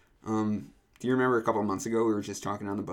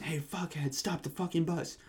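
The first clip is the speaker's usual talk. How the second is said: hushed mock yell, raised pitch; retold yell; 6 semitones higher, 7 dB quieter than usual. Rider demanding the bus stop, soft mock yell. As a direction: imitate yelling without the volume. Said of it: "he's, like, yelling".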